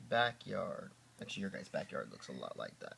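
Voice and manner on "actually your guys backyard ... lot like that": ordinary delivery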